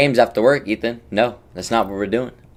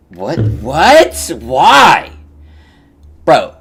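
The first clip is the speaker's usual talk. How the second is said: exasperated disbelief; 4 semitones higher, 10 dB louder, much slower than usual. Real, not acted. Exasperated at exes popping up.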